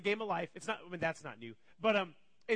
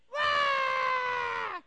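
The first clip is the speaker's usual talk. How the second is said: high, loud, drawn-out mock wail; screaming child's wail; 6 dB louder than usual. Eden after being punched by Colin, screaming wail. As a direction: imitate a little kid's screaming cry. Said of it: "Eden's still screaming".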